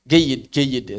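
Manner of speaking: Geyid, geyid in an Egyptian street accent with hard g; Egyptian colloquial. Shown as a demonstration. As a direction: mimic Egyptian Arabic saying Geyid. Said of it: "Makanya di Mesir nggak ada Jamal".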